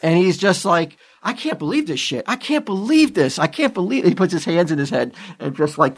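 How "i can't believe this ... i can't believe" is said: higher-pitched mock dismay; disbelieving, pitch raised in exasperation; retold upset disbelief. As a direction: imitate someone really upset, without shouting. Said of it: "He's fucking really upset".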